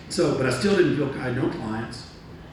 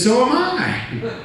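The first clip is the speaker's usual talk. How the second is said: amazed reply; 12 semitones higher, 6 dB louder, much slower than usual. Retold or imitated. Boss amazed same hotel.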